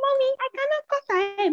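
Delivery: high, quick pleading retelling; acted child's protest. Child refusing mother's order, acted pleading.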